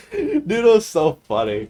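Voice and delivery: can't stop laughing as you say it. Real, not acted. Laughing at the memory.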